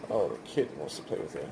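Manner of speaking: ordinary delivery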